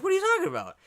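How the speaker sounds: squeaky high-pitched disbelief; high squeaky mock voice. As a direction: squeak it out in shock. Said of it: "he was just like".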